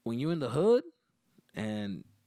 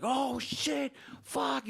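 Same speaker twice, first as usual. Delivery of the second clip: shocked outburst; 11 semitones higher, much slower than usual. Retold or imitated. Kid sees blood in fight.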